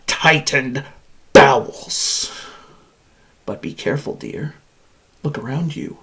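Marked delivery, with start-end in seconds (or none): 3.4-6.0 sinister warning. Acted. Machine warns woman captive, sinister hush. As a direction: drop to a confiding hush.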